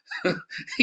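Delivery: a quick high burst of laughter; a sudden high laugh; short laugh. Real, not acted. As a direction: laugh out at the absurdity. Laughs mid scolding.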